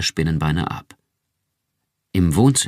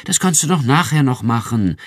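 urging; 6 semitones higher, 4 dB louder than usual. A narrator's reading. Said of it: "drängte sie sie".

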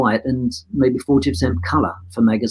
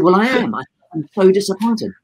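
higher, insistent mock disappointment; retold disappointment; 6 semitones higher than usual. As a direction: pitch it up and press the point. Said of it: "I said".

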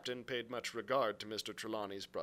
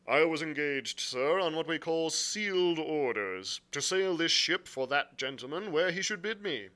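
louder, firm captain's voice; blunt sea captain; 7 semitones higher, 8 dB louder than usual. Acted. Captain explaining his orders to the gentlemen, bluntly. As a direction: state the grievance firmly and formally. Captain's dialogue beside narration.

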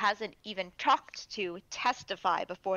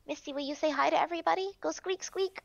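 high, fast coaxing pet voice; 7 semitones higher, faster than usual. Real, not acted. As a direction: coax the pet sweetly.

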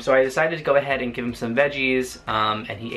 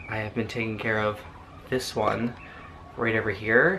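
normal talking voice

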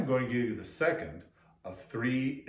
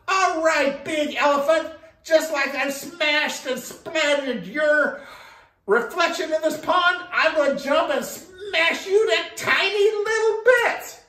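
high, loud threat from a small frog; triumphant little frog; 9 dB louder than usual. Acted.

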